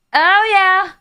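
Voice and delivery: playful hype; excited oh yeah. Shown as a demonstration.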